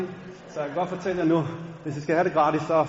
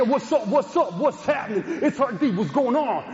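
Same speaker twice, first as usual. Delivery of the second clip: high, louder rapper hype; mock rapper on the phone; 6 semitones higher, 4 dB louder than usual. Answering friend Josef's call, high hammed-up rapper swagger. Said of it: "så tog jeg den her".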